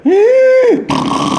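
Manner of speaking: very high, loud squeal into rattle; whistling snore done by mouth; imitated snore returning. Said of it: "five minutes later".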